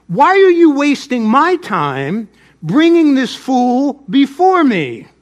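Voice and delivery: an affronted king scolding a subject; indignant rebuke. Acted. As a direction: lay into them, drawing out each phrase. Lion rebukes tiger in the fable.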